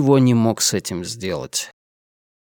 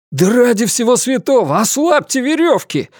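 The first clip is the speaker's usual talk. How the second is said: exclaiming; 10 semitones higher than usual. Acted. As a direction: cry out in protest. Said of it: "воскликнул он". (возмущённое восклицание)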